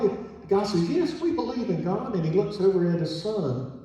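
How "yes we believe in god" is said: high, lifted acted reply; retold emphatic yes, high-pitched; emphatic affirming. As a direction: affirm it brightly, pitch well up. Car wash man's emphatic reply retold.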